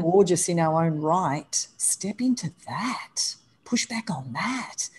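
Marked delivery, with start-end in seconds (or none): 1.8-4.9 slow, intimate whisper. Real, not acted. Host urging listeners to accept their bodies, in a slow whisper. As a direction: lean in and whisper the encouragement.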